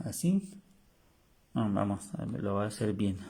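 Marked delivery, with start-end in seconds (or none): none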